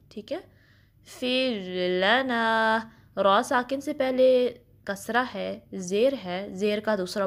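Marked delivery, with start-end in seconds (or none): 1.1-2.9 very slow drawn-out chant; slow tajweed recitation; Quranic recitation. Shown as a demonstration. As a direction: recite it slowly, stretching the vowels. Recites next phrase slowly.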